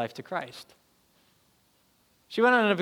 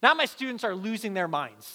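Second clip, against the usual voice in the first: normal talking voice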